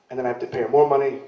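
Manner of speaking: ordinary talk, nothing marked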